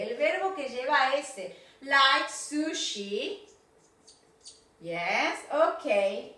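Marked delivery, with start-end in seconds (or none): 1.8-3.5 Like sushi drawn out, rising on Like; teacher models the phrase; slow model phrase. Shown as a demonstration. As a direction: model it slowly for the class. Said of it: "haciendo sonar la teacher".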